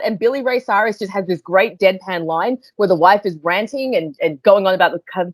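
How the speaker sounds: usual speaking voice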